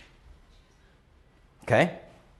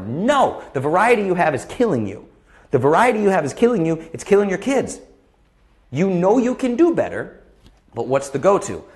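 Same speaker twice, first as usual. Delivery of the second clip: higher and louder, pushing hard; emphatic rebuttal; 7 semitones higher, 6 dB louder than usual. Real, not acted. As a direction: hammer the point home to parents. Rebuts the complaint forcefully.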